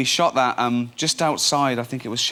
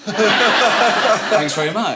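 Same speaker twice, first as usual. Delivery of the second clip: laughing; 8 semitones higher, 8 dB louder, much slower than usual. Real, not acted. Sarcastic reply after mum's jibe.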